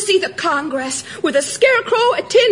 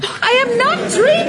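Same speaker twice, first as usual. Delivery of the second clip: higher-pitched indignant insistence; indignant protest; 6 semitones higher than usual. Acted. Girl defending herself from claims she is dreaming, indignant.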